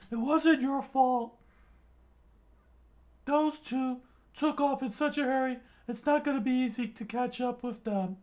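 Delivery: put-on high, bright young sidekick voice; high cartoon boy voice. A narrator's reading. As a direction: play an eager young pirate. Usopp voice.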